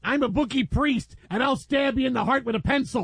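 comic bit voice, pushed high; mock threatening character. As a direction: play a silly menacing tough guy. Quoting an old threat bit.

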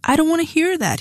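high, desperate shout. A narrator's reading. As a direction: shout to drown her words out. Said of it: "he shouted".

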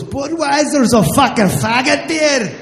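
loud Scottish brogue, slurred; mock drunk Scottish barman; drunk Scottish bartender. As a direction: bellow it like a drunk Scot in a pub. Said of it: "He goes".

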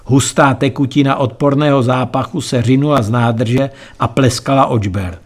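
ordinary delivery, nothing marked

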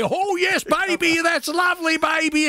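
high, gushing falsetto; gushing reply. Retold or imitated. Neville answering the landlady, high gushing falsetto. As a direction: gush it up high like a lovesick fool. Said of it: "And I'd say".

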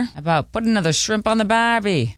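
half-hearted Aussie impression, barely there; bad Australian accent. Newsreader jokingly tries a weak Australian accent. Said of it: "That was a bad impersonation".

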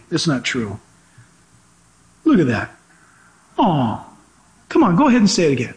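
normal talking voice

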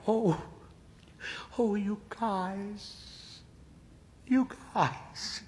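high, slow, choked-up voice; on the verge of tears, deeply moved; tearful. A narrator's reading. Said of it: "Hermione begins to cry".

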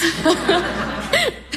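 a high, amused laugh; a short, bright giggle. Acted. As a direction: laugh at the memory. [name] laughing at memory.

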